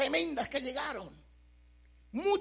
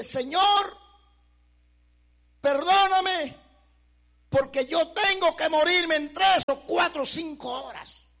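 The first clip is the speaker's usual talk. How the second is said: raised, pleading cry to God; desperate plea; 5 semitones higher, 8 dB louder than usual. Retold or imitated. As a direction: beg forgiveness, voice lifted and louder. Retold prayer expecting death. (súplica desesperada en voz alta)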